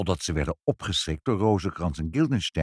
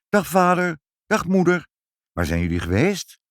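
lightly voiced eager child, higher pitch; excited child greeting; 7 semitones higher, 3 dB louder than usual. Child greeting her parents home from an outing, eager. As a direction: greet them in a hint of a child's voice.

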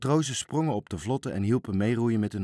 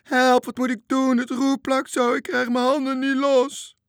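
high, loud panicked wail; frightened shrieking; 8 dB louder than usual. A narrator's reading. Scared Toontje, stuck to the wheel, begs for help, shrieking. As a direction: keep shrieking in terror at the top of the voice. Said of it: "gilde bange Toontje".

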